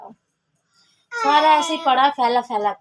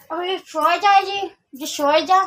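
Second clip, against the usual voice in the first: raised, slow coaxing to sleep; 6 semitones higher, 5 dB louder than usual. Real, not acted. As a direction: firmly urge the little one to sleep. Mother urging child to sleep. (ऊँची आवाज़ में बच्चे को सुलाना)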